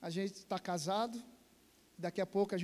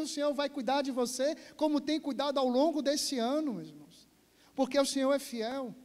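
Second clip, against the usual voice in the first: full-force preaching; 5 semitones higher, 4 dB louder than usual. Acted.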